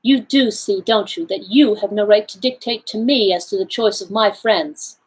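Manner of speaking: plain reading voice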